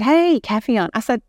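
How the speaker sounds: put-on woman cheerfully hands a birthday cake to a boy; joyful greeting. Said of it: "And I said".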